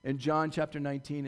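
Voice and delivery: usual speaking voice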